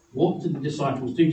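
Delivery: usual speaking voice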